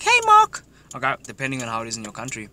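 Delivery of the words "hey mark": high, loud falsetto greeting; gushing girl. Acted. Girl greeting Mark by name, gushing falsetto. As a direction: squeal it like a delighted girl. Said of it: "she'll be like".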